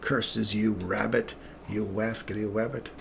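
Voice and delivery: mock grumble at the rabbit that got away; cartoon hunter voice. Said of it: "I just go".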